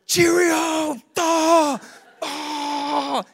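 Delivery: high, loud, silly exclamations; mock giddy shouting. Preacher mocking constant excitement in grocery store, shouted high. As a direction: ham up nonstop euphoria.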